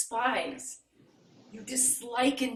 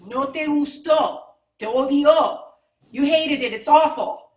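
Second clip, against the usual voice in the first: heated accusation; 2 semitones higher, 10 dB louder than usual. Acted. Improv scene, heated Spanish accusation.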